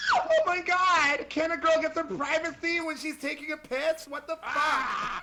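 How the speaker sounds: woman caught on the toilet yelling at the intruders; outraged surprise. Acted. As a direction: shriek at the intruder in mock outrage.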